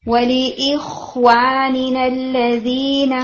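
وَلِإِخْوَانِنَا الَّذِينَ recited slowly with the kha held; slow Quranic recitation; recitation demo. Shown as a demonstration. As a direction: let the kha sound run before moving on. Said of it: "خواہ ساکن آیا تھا".

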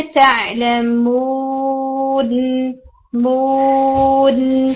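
تعلمون very slowly, with the final مون hummed long through the nose; drawn-out tajweed recitation of تعلمون; ghunna demo. Shown as a demonstration. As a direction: hold the nasal ghunna on مون right after the hit. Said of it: "آپ نے ہٹ کیا نا نون کو".

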